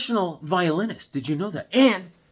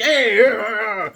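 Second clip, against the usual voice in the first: awkward; 5 semitones higher, 7 dB louder, slower than usual. Retold or imitated. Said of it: "I come in like".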